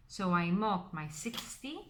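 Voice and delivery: ordinary delivery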